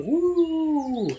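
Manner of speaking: excited whoop. Real, not acted. Hype at Bandersnatch.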